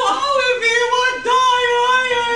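high, loud, slow wail; crying lament. Acted. Father joining the crying in the cellar, mock wail. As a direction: moan it out like a comic lament. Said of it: "her father starts crying too".

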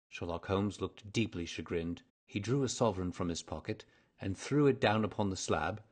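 ordinary delivery, nothing marked

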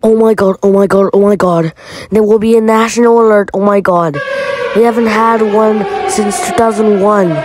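loud frantic panic; panicked shouting. Acted. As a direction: blurt it out fast in total panic. Panic at nuke alert.

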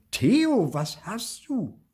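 mother calling through a closed door. A narrator's reading. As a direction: call out as a concerned mother. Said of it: "fragt Mama durch die Tür".

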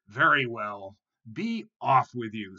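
lightly voiced troll, loud; a loud, grudging dismissal; gruff-tempered troll. A narrator's reading. Troll lets tiny goat go, lightly voiced loud dismissal. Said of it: "said the troll".

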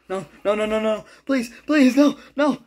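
high, loud desperate begging; rising panic, begging it to stop; panicked pleading. Real, not acted. Pleading while being attacked.

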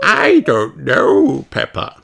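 slow, lightly voiced granny; Granny Pig's voice. Granny answering Peppa, lightly voiced. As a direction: answer like a puzzled grandmother. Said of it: "says Granny Pig".

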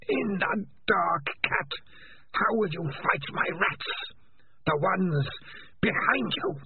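slow, high and sinister; drawn-out mocking creature voice; eerie alien voice. Alien voice taunts.

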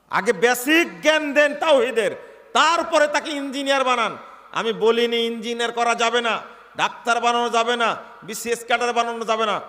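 full-force preaching; fiery preaching. Real, not acted. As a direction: preach it hard to the hall.

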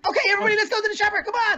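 high eager rallying call; rally everyone up cheerfully; excited. Real, not acted.

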